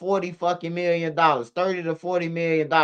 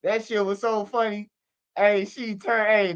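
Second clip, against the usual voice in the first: usual speaking voice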